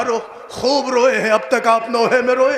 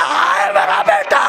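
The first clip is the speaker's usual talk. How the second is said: a father's cry, pitched very high and loud; ہائے کی بلند چیخ، ماتمی انداز میں; grief-stricken wail; 4 dB louder than usual. Acted. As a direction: let the grief break out in a shout over the son. Father's cry over dead son.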